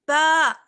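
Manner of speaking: loud, clear ba from the chest; open full voice. Shown as a demonstration. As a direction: push the sound of با out clearly. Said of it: "آواز کو آپ جو ہے باہر نکالتے ہوئے پڑھیے".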